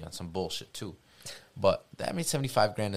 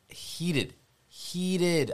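fired up; 10 semitones higher, 5 dB louder than usual. Real, not acted. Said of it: "I was, bro".

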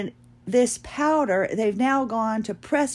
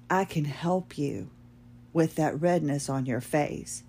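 lower, softer confidential offer; lightly voiced saleswoman; 5 semitones lower, 5 dB quieter than usual. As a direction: imitate a discreet makeup seller. Retold makeup seller offers help.

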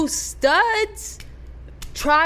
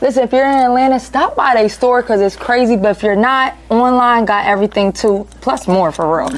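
ad read; 7 semitones lower, 7 dB louder than usual. Acted. Sponsor ad read.

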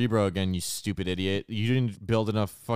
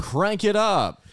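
hype call; 10 semitones higher, 5 dB louder, slower than usual. Acted. Hyped echo.

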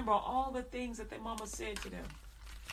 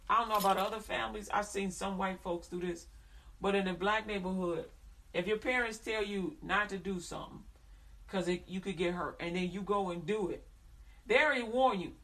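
normal talking voice

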